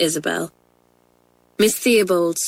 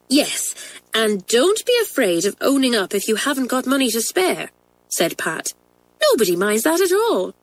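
put-on higher, eager girl comforting a friend; reassuring schoolgirl; 4 semitones higher than usual. Pat reassuring Kathleen about owning up, eager girl voice. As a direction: cheer her friend on.

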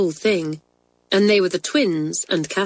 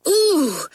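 a high, shivering ooh from the cold; shivery ooh; 9 semitones higher than usual. A narrator's reading. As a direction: shiver the ooh as the blankets come off. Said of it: "shivered the girls".

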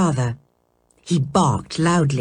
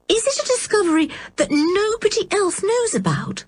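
put-on much higher, wide-eyed little girl; admiring girl; 14 semitones higher than usual. A narrator's reading. Anne asks her clever uncle in admiring awe. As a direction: ask in awe like a young girl. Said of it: "her eyes wide with admiration".

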